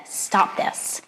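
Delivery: whispered harsh order from a jealous king; hissed command. A narrator's reading. Narrator voicing Herod's order, a whispered harsh command. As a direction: breathe it out as a threat.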